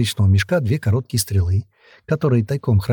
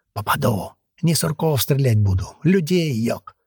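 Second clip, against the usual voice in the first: grumbling under breath; 3 semitones higher than usual. A narrator's reading. Old archer answering the heir, grumbling under his breath. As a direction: grumble it lightly to yourself. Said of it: "проворчал под нос степняк".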